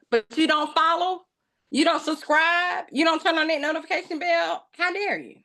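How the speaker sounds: high-pitched playful scolding of viewers; mock scolding. Vlogger teasing viewers who don't subscribe, mock indignant. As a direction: tease the viewers for not subscribing, in mock outrage.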